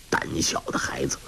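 whispered, soft and low insult; contemptuous whispered jibe at the timid boy; scornful whisper. A narrator's reading. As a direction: breathe the scorn under your breath. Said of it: "韩宝驹轻轻骂道".

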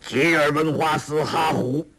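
a lightly voiced old villain chanting gibberish; reading strange scripture. A narrator's reading. Ouyang Feng reads the fake scripture aloud, haltingly. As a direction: sound out the foreign words haltingly. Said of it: "又念".

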